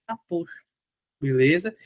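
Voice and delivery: ordinary talk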